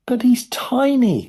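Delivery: high, loud, drawn-out wail; dismayed cry. A narrator's reading. Mother horrified at shrunken son, dismayed cry. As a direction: wail it out in alarm. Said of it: "cried Missus T V".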